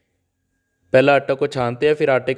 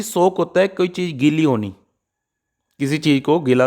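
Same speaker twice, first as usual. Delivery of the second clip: ordinary talk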